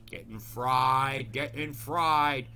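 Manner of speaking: slow dramatic. Pretend.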